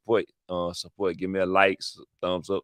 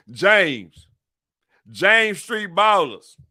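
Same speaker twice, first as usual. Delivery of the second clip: loud, raised team shout-out; calling out; 8 semitones higher, 8 dB louder, much slower than usual. Acted. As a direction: holler the crew's name, drawn out. Calling out the rival street team.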